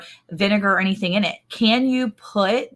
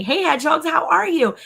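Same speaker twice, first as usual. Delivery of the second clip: bright greeting; 9 semitones higher than usual. Real, not acted. Greeting live chat viewers.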